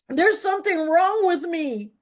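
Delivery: blurting it out to the whole room; earnest confession. Retold or imitated. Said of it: "I'm like".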